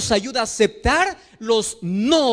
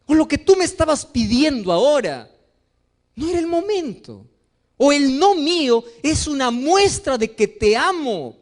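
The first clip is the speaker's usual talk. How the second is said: ordinary talk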